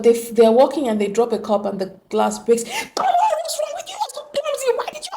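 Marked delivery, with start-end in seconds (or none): 2.6-4.0 much higher, shrill exasperated scold; a parent yelling at a child who broke a glass. Retold or imitated. 4.0-5.1 put-on the same parent nagging the child again; scolding parent. Parent scolding child over broken glass.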